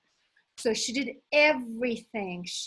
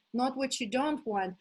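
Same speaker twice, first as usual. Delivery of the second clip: usual speaking voice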